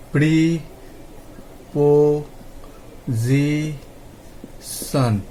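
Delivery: প্রিপোজিশন broken slowly into pri, po, zi, shon; syllable by syllable. Shown as a demonstration. Teacher showing learners how a word splits, প্রিপোজিশন said syllable by syllable. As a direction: draw out each syllable of প্রিপোজিশন with long gaps between. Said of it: "ছোট ছোটভাবে ভেঙে নিয়ে উচ্চারণ".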